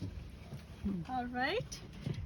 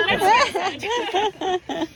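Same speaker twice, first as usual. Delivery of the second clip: laughing; 4 semitones higher, 12 dB louder, much slower than usual. Real, not acted. Laughing joke about groom.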